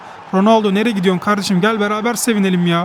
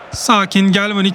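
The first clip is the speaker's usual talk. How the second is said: ordinary talk, nothing marked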